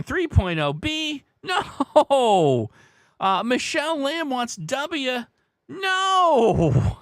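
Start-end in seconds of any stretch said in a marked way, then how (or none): 1.4-2.7 loud, high, drawn-out no; emphatic rejection. Acted. Host turning down a viewer's letter guess, emphatic rejection. 5.7-7.0 loud, high-pitched long no; emphatic rejection. Acted. Host turning down Michelle's guess, big emphatic rejection.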